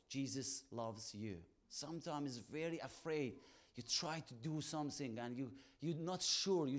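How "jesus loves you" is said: slow and simple. Shown as a demonstration. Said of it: "Sometimes small word".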